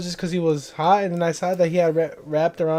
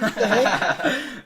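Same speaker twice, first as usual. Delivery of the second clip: higher, drawn-out slow laughing exclamation; incredulous through laughter; laughing disbelief; 6 semitones higher, much slower than usual. Retold or imitated. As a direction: break into laughter on the words. Amused disbelief at brother's plan.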